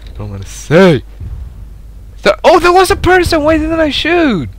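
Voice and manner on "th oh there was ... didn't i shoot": very high cry of disbelief; dismayed outcry. Real, not acted. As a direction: wail it high at a missed chance. Realises a missed shot.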